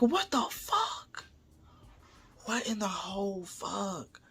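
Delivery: slow, hushed disbelief; stunned disbelief. Retold or imitated. Telling why he dipped out, hushed stunned disbelief. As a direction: stretch each word out, barely voiced. Retold stunned reaction to the scare.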